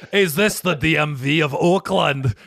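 loud hammed-up Scottish accent; playful fake Scottish caller; a joking Scottish brogue on the phone; mock Scottish accent. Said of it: "in a Scottish accent".

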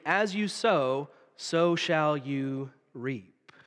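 very slow, inviting the congregation in; slow unison recitation; slow call to recite. Shown as a demonstration. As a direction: lead the room in the verse. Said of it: "Say it with me".

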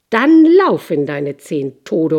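angry king. Acted. Furious king sends him away.

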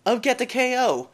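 high, loud show-host welcome; announcer welcome. Acted. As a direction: open the show like a big announcer. Hosts opening welcome.

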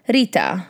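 "Rita" with a rolled R; Arabic pronunciation. Shown as a demonstration. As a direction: roll the R in "Rita", Arabic style. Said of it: "in Armenian or in Arabic, she would be called".

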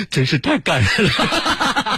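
mock-tearful child. Narrator imitates a tearful boy, laughing. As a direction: drag it out like a touched kid, half laughing. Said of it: "流眼泪说".

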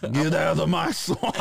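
hammed-up ogre guarding his swamp; ogre impression. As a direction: shoo them off like a cartoon ogre. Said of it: "this bitch looks like Ogre".

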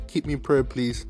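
usual speaking voice

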